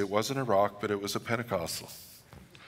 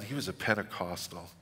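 dropped low and soft as a teasing aside; joking aside; 5 semitones lower, 7 dB quieter than usual. Shown as a demonstration. Preacher teasing the congregation, quiet joking aside. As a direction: murmur "Pentecostal" as a wink to the room. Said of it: "I say that jokingly".

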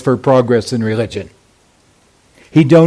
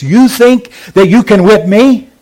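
drill sergeant yell; 7 semitones higher, 4 dB louder than usual. Retold or imitated. Drill sergeant challenging recruit.

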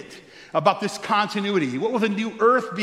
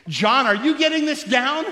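higher, louder urgent call; God calling to John; 7 semitones higher, 4 dB louder than usual. Retold or imitated. God urging John the scribe, higher and louder. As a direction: press John to take it down. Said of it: "God says".

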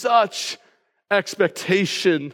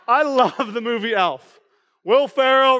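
ordinary talk, nothing marked

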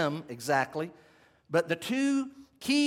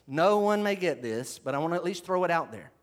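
normal talking voice

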